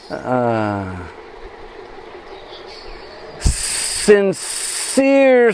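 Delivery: very slow, drawn-out serpent voice with long pauses; snake voice. Acted. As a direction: stretch every word like a great snake speaking. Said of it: "Krishna begins to imitate the voice of that snake".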